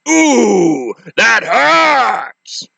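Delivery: hammed-up whine of pain; villain in pain. Villain pulls the heel from his forehead, a hammed-up whine. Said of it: "he was like".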